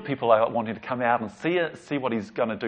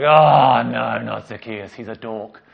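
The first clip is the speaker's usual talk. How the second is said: mock crowd groan; 8 dB louder, slower than usual. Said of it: "They're like".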